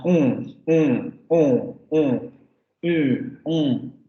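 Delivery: "un" as a nasal vowel, each one separate and falling; French nasal vowels. Shown as a demonstration. Teacher demonstrating French nasal vowels, "un" nasalised. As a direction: sing out each "un" through the nose, no hard N. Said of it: "Con la nasalización".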